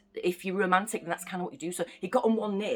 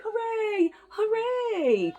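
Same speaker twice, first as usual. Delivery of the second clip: cheering; 14 semitones higher, 3 dB louder, much slower than usual. Retold or imitated. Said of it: "everyone was like".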